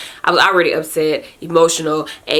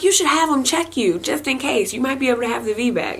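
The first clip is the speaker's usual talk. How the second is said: mom voice; 8 semitones higher than usual. Retold or imitated. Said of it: "She's like".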